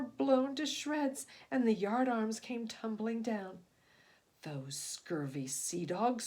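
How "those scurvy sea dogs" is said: low, slow growling snarl; low slow snarl at the pirates. A narrator's reading. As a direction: snarl it through bared teeth. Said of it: "snarled the serpent".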